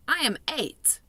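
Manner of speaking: a hint of a young girl; Sophie's voice. A narrator's reading. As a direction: read her as a polite small girl.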